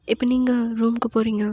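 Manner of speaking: ordinary delivery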